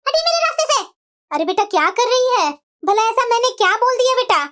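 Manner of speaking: huffy married daughter; offended daughter. Acted. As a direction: sulk and storm out. Daughter snaps, complains.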